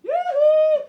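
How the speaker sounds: high, loud camp falsetto yoo-hoo; hammed-up high-pitched greeting, laughing; mocking sing-song call. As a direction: squeal it out like calling to pals across the street. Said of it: "he's like".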